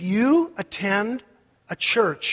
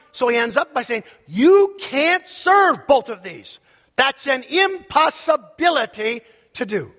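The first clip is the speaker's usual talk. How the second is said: slow, raised preacher's voice; emphatic preaching; 5 semitones higher, 4 dB louder than usual. Real, not acted. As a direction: drive each key word home loudly. Preaches at full force.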